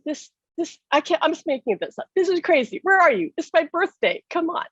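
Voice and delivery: high, frustrated pleading; upset and angry. Retold or imitated. Widow demanding her husband appear, high and frustrated. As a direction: demand he show up, hurt and cross. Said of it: "upset and angry".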